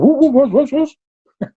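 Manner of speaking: high-pitched exasperated what-what-what; upset teacher. Retold or imitated. Teacher scolding pupils who skipped the words, high exasperated outburst. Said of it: "would get so upset".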